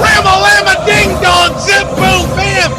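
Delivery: radio announcer screaming over a go-ahead home run; excited home run call. Real, not acted. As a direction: let it explode as the ball leaves the park, yelling with pure joy.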